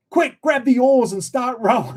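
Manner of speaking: high, urgent panicked order; frantic high-pitched command; mock panic. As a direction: imitate a mate starting to panic. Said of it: "he's starting to panic as well".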